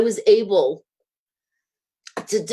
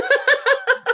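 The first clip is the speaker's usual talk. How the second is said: high laugh near tears; laughing off the crying; teary laugh. Real, not acted. Admitting she cried, a high teary laugh.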